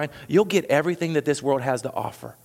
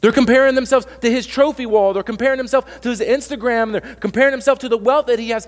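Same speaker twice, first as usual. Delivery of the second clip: high, loud hammered repetition; preaching at full force; 8 semitones higher, 5 dB louder than usual. Real, not acted. Pastor hammering how the disciples compare themselves, loud and high. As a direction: pound each line, high and loud.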